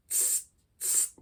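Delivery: hissed unvoiced tss like a hi-hat. Shown as a demonstration. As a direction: hiss short tss like a snake. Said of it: "Like you're doing tss".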